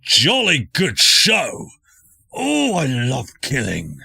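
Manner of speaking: high, loud, drawn-out battle glee; gleeful sword voice. Acted. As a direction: boom it out slowly as a bloodthirsty talking sword. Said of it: "you hear this voice in your head that just goes".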